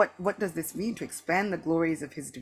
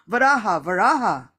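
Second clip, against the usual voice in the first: loud, raised cry of a name; shouting; 5 semitones higher, 11 dB louder than usual. A narrator's reading. The Lord hurries past his devotee, shouting. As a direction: call it out as the Lord rushing past.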